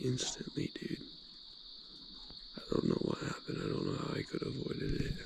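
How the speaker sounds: soft early morning whisper. Real, not acted. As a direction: whisper so no one wakes. 4am, quiet at the lake.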